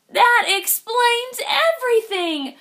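high, loud eureka cry; excited frog. Acted. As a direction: burst out with sudden delight. Frog's excited realization.

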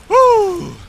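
high rush of relief, breath out; relieved whoosh. Real, not acted. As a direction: blow out a high relieved whew. After another scare.

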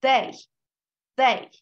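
They started with a voiced th, tongue between the teeth; lisped th demo. Shown as a demonstration. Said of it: "Burada da bakın bir pelteklik vardır".

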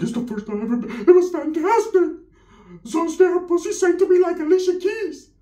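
high-pitched, giddy retelling; giddy high voice bragging about the party; high excited primo voice. Retold or imitated. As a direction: squeal it out like a thrilled kid.

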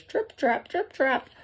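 high, light trip-trap patter; dainty hooves tapping across a bridge; little goat hoof steps. A narrator's reading. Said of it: "feet made that sound on the bridge".

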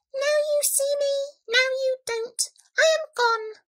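high, dainty little voice; obliging mouse. A narrator's reading. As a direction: squeak a polite leave-taking. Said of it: "murmured the Mouse".

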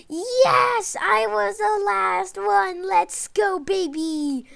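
high, loud triumphant cheer; victory cheer. Real, not acted. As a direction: celebrate the win out loud. Celebrating the win.

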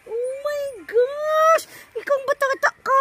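high, drawn-out exclamation; playful exasperation. Real, not acted. Scolding the hair-pulling baby, high playful exasperation. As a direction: stretch it out high at the naughty little one.

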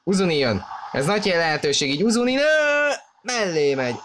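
excited hungarian sports commentary. Real, not acted. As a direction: shout the chance, then drop. Big chance missed. (izgatott sportközvetítés)

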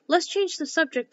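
yawning interruption. A narrator's reading. March Hare tires of the topic, yawning interruption. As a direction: break in as if yawning.

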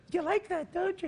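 high, teasing put-on voice with a laugh; mock Jay Leno tease. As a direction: coo it like a flirting Jay.

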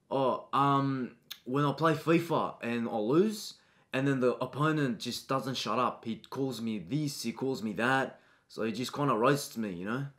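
low, soft, mumbling schoolboy; timid student. Acted. Shy boy answering a pushy teacher, soft and low. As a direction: shrink away from the teacher, quietly. Student says what makes him angry.

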